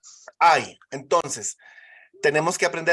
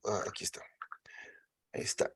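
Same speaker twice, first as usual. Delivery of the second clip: whispering to herself while searching the screen; a soft unvoiced mutter under the breath; murmured aside to herself; whispered aside; 8 semitones lower, 14 dB quieter, much faster than usual. Real, not acted. Teacher muttering to self at computer.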